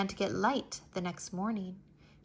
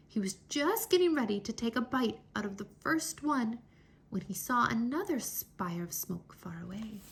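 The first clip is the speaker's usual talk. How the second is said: plain reading voice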